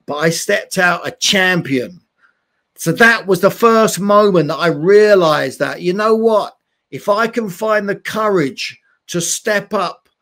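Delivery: ordinary talk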